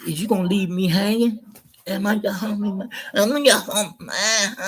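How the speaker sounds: high, pleading street-buddy voice; wheedling homie. Retold or imitated. A hustler coaxing the speaker back into the liquor store, high and pleading. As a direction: whine like a hustler begging a favor. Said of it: "He said".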